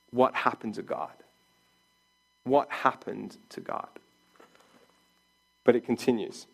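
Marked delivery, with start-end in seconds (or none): 0.1-3.9 low, soft, puzzled musing; bewildered inner thought. Retold or imitated. Elijah after the fire wondering softly, low and bewildered. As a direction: wonder it quietly to yourself. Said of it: "is thinking".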